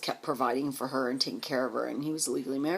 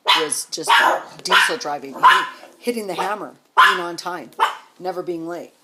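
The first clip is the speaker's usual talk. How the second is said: emphatic; 11 dB louder than usual. Real, not acted. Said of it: "building emphasis on Tim's grind".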